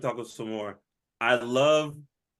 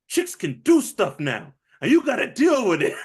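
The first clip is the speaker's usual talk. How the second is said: mock Trejo voice; 7 semitones higher, 5 dB louder than usual. Danny Trejo quote.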